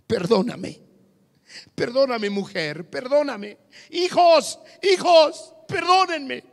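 acted pleading apology. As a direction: beg wife and children for forgiveness. Adulterer voiced begging family.

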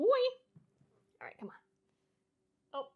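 high soft whisper to a small dog; whispered coaxing to dog. Real, not acted. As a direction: coax the dog along in a whisper.

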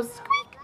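tiny, very high squeak; little high-pitched mouse peep. Shown as a demonstration. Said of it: "when I say mouse, you go".

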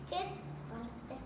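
normal talking voice

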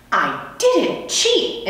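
high, slow insistent denial; insisting. Acted. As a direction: dig in and insist. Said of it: "insisted Heidi".

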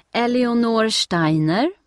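loud, slow call across a crowded hall; a lady reading names off a list, calling loudly. A narrator's reading. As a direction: call the name out to the waiting crowd. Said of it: "hör Steffi damen ropa".

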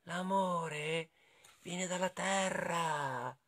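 soft, slow worm voice; earthworm. A narrator's reading. Worm answering the friends, soft slow voice. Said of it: "dice il lombrico".

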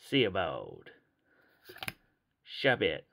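put-on cowboy-like American voice; dodgy American accent. Collector naming cards for viewers, dodgy American accent. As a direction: read card names in an amateur American accent. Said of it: "gonna try my best American accent".

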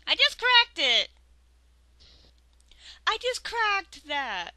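loud high complaint; exasperated. Real, not acted. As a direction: whine it in disbelief.

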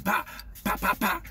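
short percussive pops; imitated spray pops. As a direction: mimic quick squirts from a bottle. Imitates spraying his bottle.

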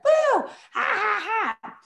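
high, loud boo and slow mocking laugh; teasing cat's scare. Neighborhood cat scaring napping Zed, teasing boo and laugh. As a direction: jump out with a boo, then draw out the ha-has. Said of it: "decides he's gonna come scare him".

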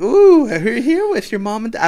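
high, loud cooing princess; exaggerated high character voice; Mulan. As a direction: talk down to him as if to a child. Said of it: "She's like".

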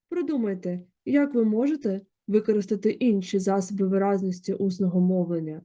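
normal talking voice

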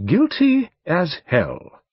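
high, slow, emphatic verdict; succinct. A narrator's reading. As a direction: pronounce it as a final verdict. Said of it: "said Mister Fogg succinctly".

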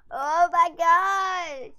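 loud, slow, stretched shout of joy; thrilled shout. Real, not acted. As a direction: yell it with delight.

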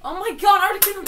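loud, high burst of surprise; real thrilled outburst; excited shout. Real, not acted. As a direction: yell with delight as it shows up. Articuno appears on cue.

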